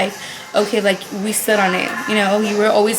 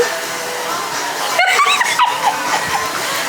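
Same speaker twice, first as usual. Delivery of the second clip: laughing. Real, not acted. Laughing during school tour.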